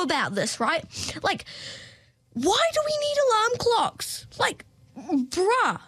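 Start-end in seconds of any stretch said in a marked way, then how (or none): 2.3-5.8 high exasperated complaint. Real, not acted. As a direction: whine about alarm clocks. Exasperated about alarm clocks.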